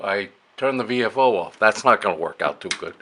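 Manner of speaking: ordinary talk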